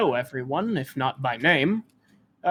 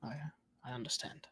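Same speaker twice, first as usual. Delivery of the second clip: very soft, hushed and grave; solemn; 18 dB quieter, faster than usual. Acted. Townsman comforting a bereaved traveler, solemn and hushed. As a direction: barely voice it, solemnly. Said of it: "nods solemnly".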